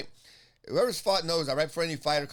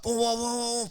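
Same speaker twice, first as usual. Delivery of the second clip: higher-pitched imitated revving noise; revved-up booming; 6 semitones higher, slower than usual. Fighter firing himself up before bout, booming noises. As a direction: rev yourself up with booms.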